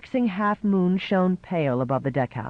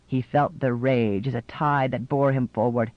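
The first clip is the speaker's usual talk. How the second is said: ordinary delivery, nothing marked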